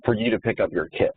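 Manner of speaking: ordinary talk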